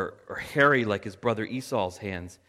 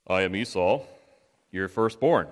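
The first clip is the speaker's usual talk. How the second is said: put-on, lower voice for an elder son; lowered character voice, announcing himself; Esau impression; 5 semitones lower than usual. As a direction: play the firstborn in an assumed voice. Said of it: "That's my Esau impression".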